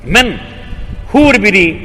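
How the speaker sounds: storyteller acting an angry visitor. A narrator's reading. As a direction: throw it back in disbelief. Sadhu retorts in disbelief.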